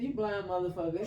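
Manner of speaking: high-pitched mimicked name-calling; mock insult. Retold exchange of insults.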